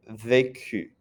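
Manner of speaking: "vécu" with "ay" in the first syllable; A sound for é. Shown as a demonstration. Teacher contrasting it with reçu, "vécu" with an "ay" vowel. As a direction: say "vécu" as vay-KU, not with a mute E. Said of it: "So you have E and A".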